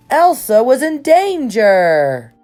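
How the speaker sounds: ordinary delivery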